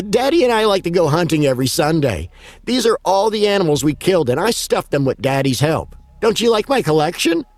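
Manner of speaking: put-on high, cheerful child voice; little girl voice. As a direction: sound innocent but creepy. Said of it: "She chuckled and said".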